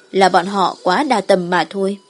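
plain reading voice

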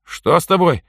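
startled by her pain; worried. A narrator's reading. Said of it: "обеспокоенно посмотрел на неё Митька". (обеспокоенно, встревоженно)

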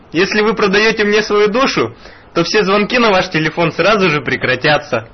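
ordinary delivery

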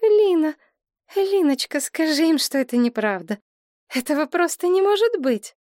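high, distraught pleading; helpless, high-voiced disbelief; babbling plea. A narrator's reading. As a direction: stammer out a plea, pitch way up. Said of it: "Она пролепетала".

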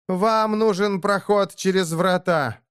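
high, slow, slightly hoarse delivery. A narrator's reading. Деэмира bargains with the orc over the gate, hoarse voice. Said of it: "произнесла она хриплым голосом".

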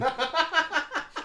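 high breathy giggle. Real, not acted. Laughs at own story.

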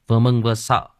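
ordinary delivery

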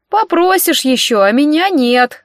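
slow, high, offended granny; huffy old mother. A narrator's reading. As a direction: grumble back slowly through a door. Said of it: "донеслось из-за двери".

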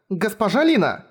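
high-pitched urgent call of a name; hasty interruption. A narrator's reading. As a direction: cut in quickly to restrain. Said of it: "Быстро прервала Амелия". (торопливо перебивая, высоким голосом)

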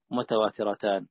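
ordinary talk, nothing marked

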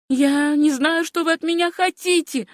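high-pitched protest; bewildered young woman. A narrator's reading. Niece questioned by angry men, high bewildered protest. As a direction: plead ignorance in a high girl's voice.

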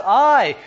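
a loud, emphatic "I". Retold or imitated. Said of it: "even more emphatic".

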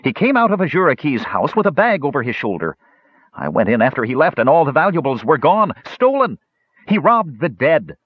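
high, rising indignant voice; outraged youth peaking on stolen; indignant accusation. Acted. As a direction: pile on the charges, cheeks quivering.

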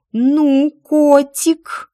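loud, high, exasperated exclamation; angry hiss. A narrator's reading. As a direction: drag it out in breathless exasperation. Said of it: "прошипела я". (сердито, с шипением)

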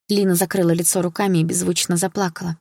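plain reading voice

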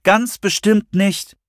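high, slow, emphatic refusal; drawn-out firm rejection; very decisive. A narrator's reading. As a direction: spell it out with total determination. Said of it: "mit aller Entschiedenheit".

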